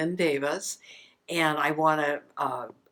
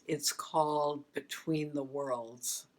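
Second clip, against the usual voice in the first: usual speaking voice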